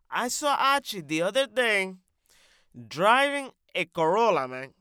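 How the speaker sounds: animated put-on accent, leaping in pitch and volume; brash comic character. Character raving about Annie's brother, brash and animated. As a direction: rave like an excitable, accented loudmouth.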